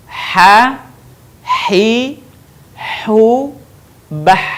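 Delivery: isolated "ha, hi, hu, bah" with strong breathed h; mid-throat H. Shown as a demonstration. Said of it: "Begitu cara melafalkan huruf H". ("ha, hi, hu, bah" dari tengah tenggorokan)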